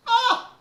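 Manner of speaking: high, loud squeal of delight. Real, not acted. Mother melting at her son's call, delighted squeal. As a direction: let out a bright, loud squeal of joy. Touched by child's text.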